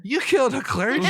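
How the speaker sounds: high, loud, quick outburst of disbelief; shocked guard. Acted. Guard reacting to a newcomer's confession, loudly shocked. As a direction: blurt it out high and loud, aghast. Guard hears of a killed clergyman.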